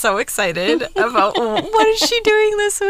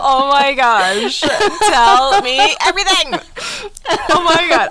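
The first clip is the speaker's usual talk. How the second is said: excited; 2 semitones higher, 5 dB louder than usual. Real, not acted. Thrilled about the Barbie topic.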